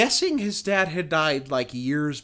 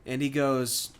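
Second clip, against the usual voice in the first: usual speaking voice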